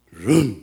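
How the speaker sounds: loud low engine rumble; imitated engine noise. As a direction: rumble like a car that won't go. Car trying to start.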